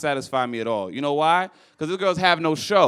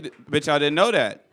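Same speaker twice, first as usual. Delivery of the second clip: ordinary talk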